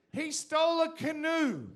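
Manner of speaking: loud, slow, laughing delivery; laughing punchline. Acted. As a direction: laugh it out while shouting.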